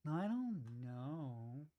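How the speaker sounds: slow, dragging mock narrator voice; Mister Chills impression. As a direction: drag every line out like the channel host. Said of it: "He talks like this".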